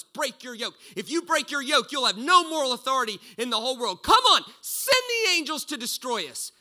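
high, loud jeering dare; mocking taunt. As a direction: goad him to strike back, preaching at full force. Mocking crowd taunting Jesus.

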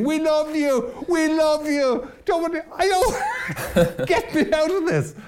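very high, slow, laughing mock cry of love; hammed-up crowd calling to a drowning man, laughing; mock shouting from the pier. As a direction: absurd loving chant at a drowning person. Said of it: "everyone on the pier starts shouting".

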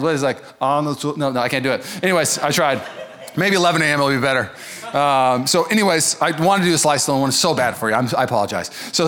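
ordinary delivery

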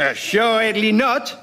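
slow, pompous French denial; French accent. Acted. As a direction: draw it out in hammy French. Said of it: "in outrageous French accent".

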